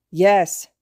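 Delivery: hurt patient, lightly voiced; patient's reply. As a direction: force the word out through pain. Patient gives consent.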